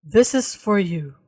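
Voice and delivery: low, slow AI assistant voice; Google Gemini voice. Acted. Co-host reading the AI's message on request, in a low Gemini voice. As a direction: read it like an emotionless machine. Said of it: "Maghimo ka Google Gemini voice".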